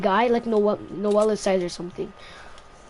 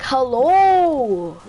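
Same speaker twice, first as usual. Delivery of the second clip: drawn-out, louder hello; exasperated call-out; 4 dB louder than usual. Retold or imitated. Student calling to a teacher busy talking, exasperated. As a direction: stretch it out to get attention.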